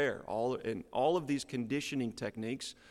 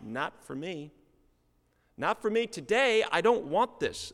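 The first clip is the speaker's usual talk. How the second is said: shrugging it off, then louder; dismissive; 7 semitones higher, 4 dB louder than usual. Retold or imitated. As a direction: brush it off as not for you. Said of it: "pass over and say".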